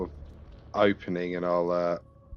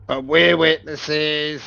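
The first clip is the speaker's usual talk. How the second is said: loud, slow, higher-pitched interjection; calling out; 9 semitones higher, 11 dB louder, slower than usual. Acted. Adventurer calls out to gang captor, loud and slow. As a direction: call it across to the captor, drawn out.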